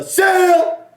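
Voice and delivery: a full, high, loud hype scream of the name; huge shout. Real, not acted. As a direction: yell it like a stadium announcer. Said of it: "I'm gonna lose my voice".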